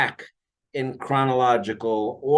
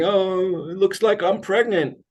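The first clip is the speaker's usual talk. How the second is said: client's complaint quoted in a higher voice; imitated complaint; 7 semitones higher than usual. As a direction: mimic her complaining, pitched well up. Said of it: "she always complains about".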